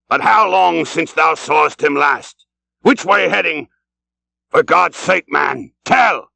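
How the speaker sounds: impatient captain demanding an answer; Ahab demanding. A narrator's reading. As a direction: press harder, breaking into a cry at the end. Ahab urgently demands the whale's course.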